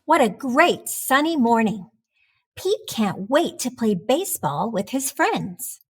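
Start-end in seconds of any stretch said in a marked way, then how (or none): none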